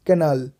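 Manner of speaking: "कनाल" with a long "ना"; heavy second vowel. Shown as a demonstration. Teacher modelling the heavy sound, "कनाल" with weighted "ना". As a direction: lean on the vowel after N in "कनाल". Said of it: "sound है वो थोड़ा heavy".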